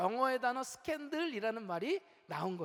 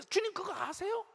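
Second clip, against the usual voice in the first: tattling disciple; 7 semitones higher than usual. Retold or imitated.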